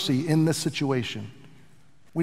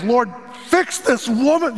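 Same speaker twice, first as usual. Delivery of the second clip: slow, exasperated mock prayer, a touch higher; 6 semitones higher, 3 dB louder, slower than usual. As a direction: pray it with a bad attitude, fed up. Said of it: "praying out of exasperation with a bad attitude".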